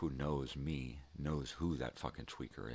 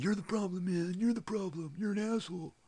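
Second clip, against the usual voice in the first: much higher, louder shrill mock yell; imitating a ranting tweaker, high and loud; 6 dB louder than usual. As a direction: ham up the coworker screaming blame. Mimics tweaker blaming him.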